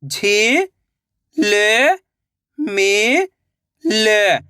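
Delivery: "झी-ल-मी-ल" very slowly, syllables apart; sounding out slowly. Shown as a demonstration. Teacher showing children how to sound out a word. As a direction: sound out "झी-ल-मी-ल" piece by piece. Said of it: "एक एक अक्षर का उच्चारण करके".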